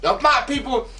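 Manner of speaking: hammed-up fury at the ignorant boss; mock outrage. Scolding the boss about the Middle Passage, mock outrage.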